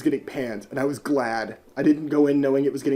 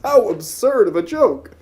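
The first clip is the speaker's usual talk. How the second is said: hammed-up, put-on cartoon goat voice; mock the talking goat with a silly accent; exaggerated accented character voice, swooping up; goofy goat accent; 4 semitones higher, 5 dB louder than usual. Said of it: "talks in an accent like this".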